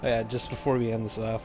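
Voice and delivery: ordinary talk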